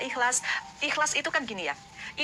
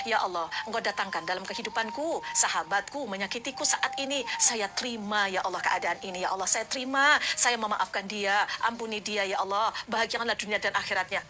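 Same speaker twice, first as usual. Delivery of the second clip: low, hushed prayer to God; 4 semitones lower than usual. Pretend. Voiced prayer, lower and softer.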